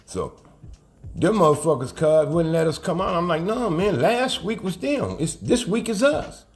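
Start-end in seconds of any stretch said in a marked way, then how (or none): 3.4-6.4 high-pitched mock protest; retold protest. Arguing with staff over whose week, retold high-pitched protest. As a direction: re-enact arguing back, pitched up.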